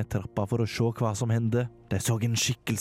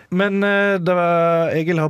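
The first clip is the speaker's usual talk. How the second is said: excited outburst; 8 semitones higher, 7 dB louder than usual. Acted. Sudden shift before story intro.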